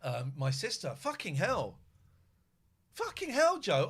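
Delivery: ordinary delivery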